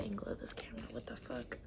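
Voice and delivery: quick whispered grumble; whispered annoyed aside; muttered whisper. Real, not acted. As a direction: complain under your breath.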